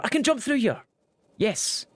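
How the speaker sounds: high, delighted "I can jump through here!"; triumphant gamer cry; excited discovery. Real, not acted. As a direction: burst out thrilled at finding a way through.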